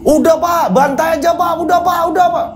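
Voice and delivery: high-pitched mob cries to the headman; frenzied villager shouting. Acted. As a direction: scream it like a mob member egging on. Villagers urging violence.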